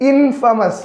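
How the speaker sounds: ഇൻഫമസ് with a short "fa", falling at the end; correct pronunciation. Shown as a demonstration. Teacher repeats the right reading for students, ഇൻഫമസ് with short "fa". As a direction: say ഇൻഫമസ് as IN-fuh-mus. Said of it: "ഇൻഫമസ് എന്നാണ് pronunciation".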